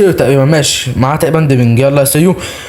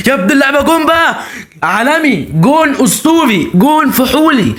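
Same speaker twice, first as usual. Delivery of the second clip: goal celebration; 8 semitones higher than usual. Real, not acted.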